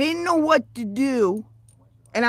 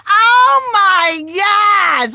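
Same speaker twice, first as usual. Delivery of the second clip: mock outrage; 7 semitones higher, 8 dB louder, much slower than usual.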